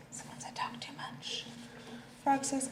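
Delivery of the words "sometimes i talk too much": soft, breathy whisper, much quieter; whispered confession. Acted. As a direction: whisper it as a little secret. Squirrel admits something.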